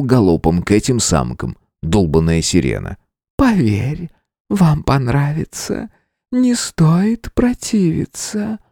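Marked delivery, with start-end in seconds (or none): 3.4-8.6 seductive siren voice. A narrator's reading. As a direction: coax him slowly like a siren. Spider siren seduces king. (соблазнительный голос паучихи)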